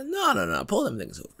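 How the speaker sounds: lightly voiced eager cop; mock cop order. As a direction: point and bark the stop out. Cop ordering a traffic stop.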